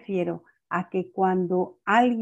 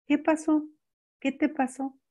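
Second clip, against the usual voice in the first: put-on higher, softly asking a child what happened; a mother bending down to a crying child; gentle mother; 6 semitones higher, 4 dB quieter than usual. (mamá que pregunta a su hija llorosa, suave y aguda)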